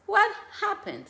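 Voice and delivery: put-on lilting child-directed question; sing-song "What happened". Shown as a demonstration. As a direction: lift it up like a gentle invitation to a child. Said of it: "just ask".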